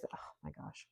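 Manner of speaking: soft unvoiced gasp of surprise; hushed surprise at the card; whispered oh my gosh. Real, not acted. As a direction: breathe it out in awe.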